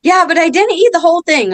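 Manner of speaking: put-on a sugared-up girl owning up to the brownie; girl's sheepish voice. Mother imitates the girl confessing, high kid voice. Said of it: "She's like".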